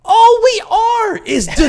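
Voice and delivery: high, loud, half-laughing shout; a real burst of frustration; exasperated shout. Real, not acted. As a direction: throw it up in exasperation. Exasperated outburst about politicians.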